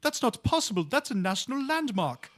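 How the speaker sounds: high-pitched caricature, pushed up. Retold or imitated. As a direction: mimic them in a high voice. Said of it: "I know that's very offensive".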